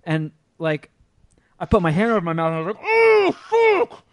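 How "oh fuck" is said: sudden high, loud yelp of pain; a much higher, louder retold shocked yelp; retold cry of pain. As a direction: cry out as the elbow smashes your teeth. Teeth shattered by counselor's elbow.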